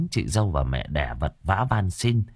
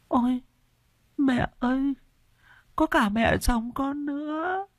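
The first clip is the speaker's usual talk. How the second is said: delirious raving. Acted. Feverish widow calls to her dead husband, high delirious wail. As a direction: rave as if seeing ghosts, pitched far up. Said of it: "mồm nói như sảng".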